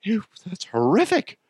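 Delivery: lightly voiced horrified onlookers; hint of squeamish disgust; mock disgusted viewers. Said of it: "looking at me like".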